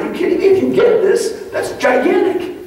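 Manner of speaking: high, slow, thrilled exclamation; excited disbelief. Retold or imitated. Speaker showing the crowd the daily thrill, high slow excited cry. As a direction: let the joy burst out up high and drawn out. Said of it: "That's why I'm excited every day going".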